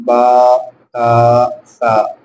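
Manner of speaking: long, level drawn-out "با، تا، سا"; separate letters. Shown as a demonstration. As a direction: hold each of "با، تا، سا" alone on a steady note. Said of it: "الگ الگ جو حروف ہیں".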